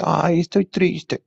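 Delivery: high, slow, whiny put-on sadness; mock mopey sigh. Mocking people who wallow in sad songs, high and slow. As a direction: mimic someone wallowing in self-pity. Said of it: "hay gente que dice".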